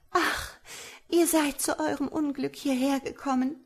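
high-pitched, fearful sigh of warning; alarmed young woman. A narrator's reading. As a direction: lament the stranger's bad luck in a high female voice. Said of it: "aber sie erschrak offenbar". (erschrockene junge Frau, hohe Stimme)

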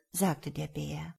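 plain reading voice

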